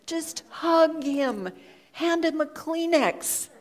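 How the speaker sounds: a mild, drawn-out inner urging. Retold or imitated. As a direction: drag it out as an insistent inner voice.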